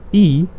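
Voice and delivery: E said as English "ee"; E as the English letter name, long "ee". Shown as a demonstration. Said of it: "we call it".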